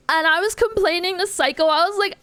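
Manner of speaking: usual speaking voice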